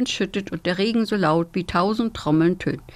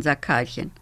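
plain reading voice

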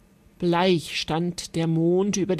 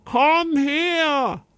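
high, drawn-out summons; calling out; 6 semitones higher than usual. A narrator's reading. Knight ordering the giantess to come, calling out. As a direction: call it commandingly across a great hall. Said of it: "rief sie".

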